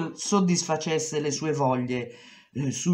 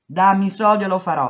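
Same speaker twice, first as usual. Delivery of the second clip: angry demand; 5 semitones higher, 8 dB louder, faster than usual. Retold or imitated. She demands her pay.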